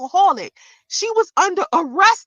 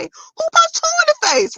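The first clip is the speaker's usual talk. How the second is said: mock disbelief; 13 semitones higher than usual. Vlogger mocking the troll's claim, high incredulous squeal. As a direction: sound utterly lost. Retelling her own confused reaction.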